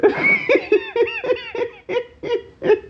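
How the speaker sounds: high wheezing laugh; crying laughing. Real, not acted. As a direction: laugh till it hurts. Laughing hard at friend.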